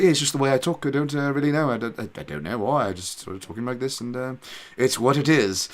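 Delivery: mock English accent, offhand and halting; put-on English accent. Arkansas theater kid defending his speech, put-on English accent. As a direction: imitate a fake Englishman shrugging it off. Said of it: "he spoke English to the point".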